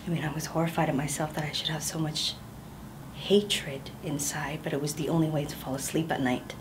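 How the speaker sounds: hushed, unvoiced confession; whispered confession. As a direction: breathe it out as a secret nobody else may hear.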